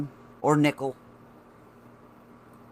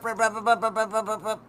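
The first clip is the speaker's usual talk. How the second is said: imitated rattling noise; 8 semitones higher, faster than usual. Imitating nickel rattling in sinus.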